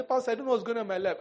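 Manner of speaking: high, fast mock lament; whining congregant. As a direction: gabble it like a helpless churchgoer. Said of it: "People come to you and say".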